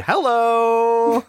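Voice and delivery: high, stretched-out hello; calling out hello. Real, not acted. Host showing he could not be heard, high calling out. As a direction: call it high and long into the void. Mimics calling during mic dropout.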